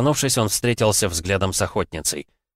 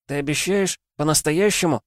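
put-on child voice; 9 semitones higher than usual. Twin asks, child voice.

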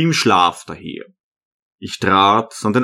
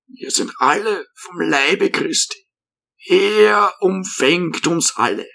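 put-on old hermit; 6 semitones higher than usual. A narrator's reading. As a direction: speak like a gentle old monk.

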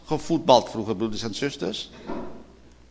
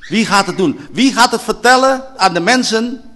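higher, louder, demanding; loud challenge; 6 semitones higher, 9 dB louder than usual. Acted. Preacher challenges the congregation, calling out loudly. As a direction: throw the question at the hall. Rhetorical question repeated louder.

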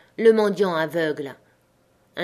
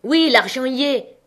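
higher-pitched call back; raised voice in reply; called-out answer; 5 semitones higher, 4 dB louder than usual. A narrator's reading. Shouted answer from the window.

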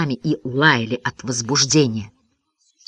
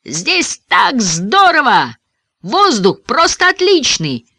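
slow, drawn-out joyful shout; elated calling; 7 semitones higher, 4 dB louder, slower than usual. A narrator's reading. Ben tumbling in warm air, elated drawn-out call. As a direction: call down from on high, thrilled.

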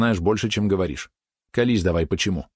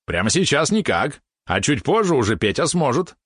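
confident; 6 semitones higher than usual. A narrator's reading. Companion explains how to check the gift, confident. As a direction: state it with cocky certainty. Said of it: "уверенно сказал Валерон".

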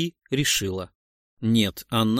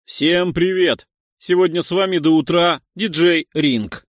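DJ Ring's voice; 5 semitones higher, 6 dB louder than usual. Pretend. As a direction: pump up the club crowd. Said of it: "произнесла его голосом".

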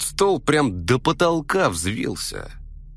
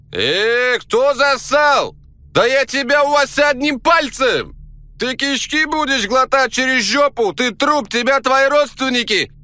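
furious yell; 13 semitones higher, 7 dB louder than usual. Retold or imitated. Hidden Caucasian voice yells back.

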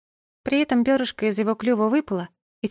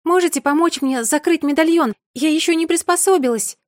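high, loud pleading call; 8 semitones higher, 5 dB louder than usual. A narrator's reading. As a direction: shout it up to the birds. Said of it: "крикнула я".

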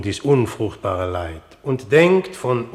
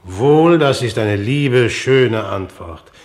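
booming, pleased royal praise; the king loudly praises Hamlet's answer; hearty loud approval; 6 dB louder than usual. Acted. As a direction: proclaim it loudly to the whole court. King hails Hamlet's obedience.

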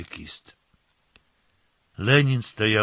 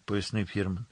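ordinary delivery

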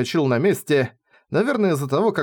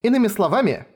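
composed, higher-pitched voice; unruffled; 8 semitones higher, faster than usual. A narrator's reading. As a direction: coolly take over the argument. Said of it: "невозмутимо сказала она". (невозмутимо)

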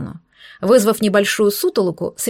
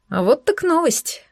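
smirking; 6 semitones higher, slower than usual. Acted. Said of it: "усмехнулся он".

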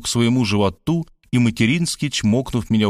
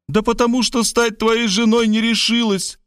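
high-pitched theatrical outburst; exclaimed with pathos; 12 semitones higher than usual. A narrator's reading. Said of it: "с патетикой воскликнула она".

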